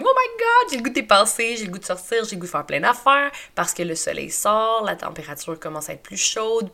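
bursting with spring excitement; excited. Retold or imitated. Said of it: "Je suis comme".

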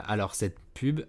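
ordinary delivery

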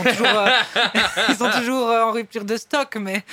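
amused, loud, laugh-broken speech. Real, not acted. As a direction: break up laughing mid-sentence. Laughs while admitting they're sold out.

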